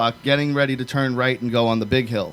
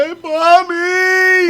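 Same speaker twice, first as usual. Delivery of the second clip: put-on much higher, slow, drawn-out child's call; little kid calling; 4 dB louder, much slower than usual. Small boy walking home dirty, calling for his mom. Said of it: "and I'm like".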